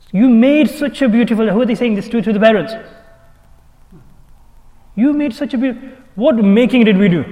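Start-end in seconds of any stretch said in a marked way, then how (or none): 0.1-1.5 mocking quote. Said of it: "I've heard this statement". 4.9-5.9 mocking quote. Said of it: "I've heard this statement".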